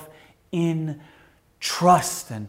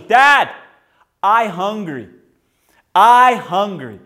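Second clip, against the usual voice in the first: put-on toddler voice; 5 semitones higher, 10 dB louder, slower than usual. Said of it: "she goes".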